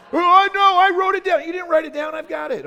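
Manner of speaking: high, loud mock protest; mock indignant shout. Real, not acted. Host playfully yelling back at crowd about keeping score. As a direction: act offended at the hecklers, loud and high.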